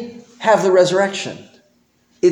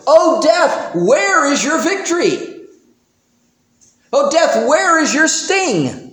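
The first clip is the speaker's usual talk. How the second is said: high, ringing proclamation; triumphant preacher's cry; preached taunt to death; 8 semitones higher than usual. Acted. Paul's taunt to death quoted.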